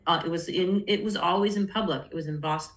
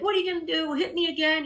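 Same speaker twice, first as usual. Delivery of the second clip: much higher, daring challenge; defiant taunt; 10 semitones higher, faster than usual. Retold or imitated. Teen taunting her abusive boyfriend, defiantly. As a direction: dare him to do it.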